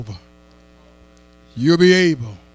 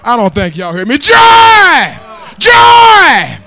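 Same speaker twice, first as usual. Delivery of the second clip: whooping preaching; 4 dB louder than usual. Acted. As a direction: chant it out at full force, rising to a cry.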